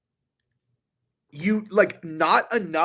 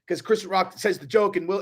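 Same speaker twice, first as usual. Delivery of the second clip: usual speaking voice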